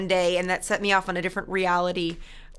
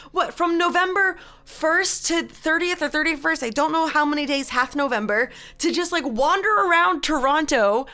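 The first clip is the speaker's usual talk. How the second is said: mock bewildered rant; 6 semitones higher, 4 dB louder than usual. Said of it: "I'm like".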